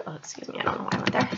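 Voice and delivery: breathy whispered self-correction under the breath; sheepish whisper, barely voiced; whispered aside. Real, not acted. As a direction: drop to a whisper to excuse yourself. Muttered aside after a slip.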